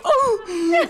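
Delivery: casi llanto de emoción fingido; gemido emocionado agudo. Retold or imitated. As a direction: chilla emocionado, lento. Reacción al saludo de Paris. (fan abrumado ante Paris, llanto emocionado agudo)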